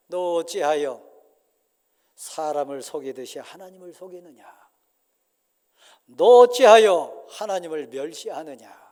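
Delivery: builds to a loud stern thunder; stern rebuke building. Acted. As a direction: end on a roaring rebuke. Peter rebuking, climaxing. (베드로가 점점 크게 꾸짖음)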